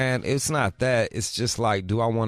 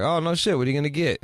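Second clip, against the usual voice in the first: fake cheerful; 7 semitones higher, faster than usual. Dad's fake upbeat reply.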